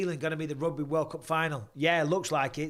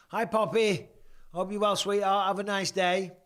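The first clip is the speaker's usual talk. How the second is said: sweet greeting; 5 semitones higher, 3 dB louder than usual. Real, not acted. Streamer giving a viewer's daughter Poppy a shout-out, sweetly. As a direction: greet a little fan sweetly, voice lifted.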